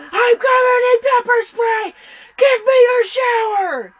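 high, loud yell imitating a frantic intruder; retold screaming. Sister high out of her mind at the door, screaming. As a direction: scream it like someone banging on the door, wild.

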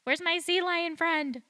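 put-on pining like a little kid; childlike wish. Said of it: "I'm like".

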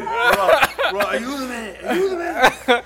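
high, loud comic begging; mock desperate plea. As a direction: beg for rescue through laughter. Stranded man begging for help.

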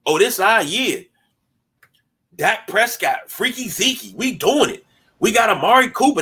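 half-hearted Cowboys fan swagger; mock Cowboys fan. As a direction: lightly play a cocky Cowboys fan bragging. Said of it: "We sound like Dallas Cowboy fans".

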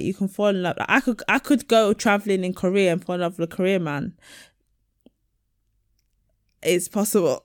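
ordinary delivery

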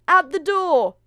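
high, very loud stepsister cry; screaming. A narrator's reading. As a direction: scream the news through the house. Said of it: "screamed the other".

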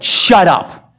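stern dismissal with a sharp falling end; stern, undignified rebuke. Shown as a demonstration. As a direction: punch it out as a crude command. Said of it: "speaking sternly to an enemy".

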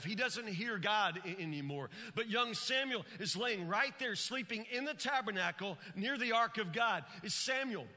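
high, driving pulpit delivery; full-force preaching. Real, not acted. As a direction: preach it with rising fervour and punched words.

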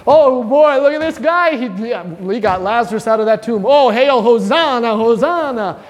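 mock-excited crowd shouting hosanna. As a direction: call it out like an eager crowd member hailing a hero. Crowd greeting Jesus entering Jerusalem.

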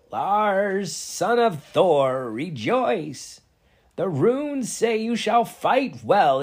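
loud, high, slow and swooping proclamation; seer's grand prophecy. Acted. Vala reads Lars's fate to the hall, proclaiming slowly and loudly. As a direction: intone the prophecy, stretching the big words.

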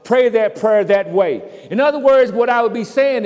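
loud, high, impassioned preaching; full-force preaching. Acted. Preacher challenging the church about lost neighbors, loud and impassioned. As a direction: hammer the congregation with the question.

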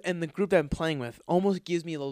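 ordinary talk

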